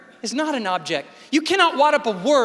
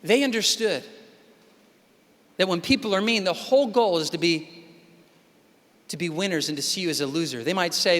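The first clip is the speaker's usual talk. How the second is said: usual speaking voice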